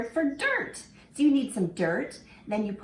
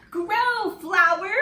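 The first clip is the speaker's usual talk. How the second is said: a hint of a small girl urging her plant, higher and louder; little girl's plea; 11 semitones higher, 6 dB louder, slower than usual. Fran urging her flower pot to grow, lightly voiced child. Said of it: "She took it home".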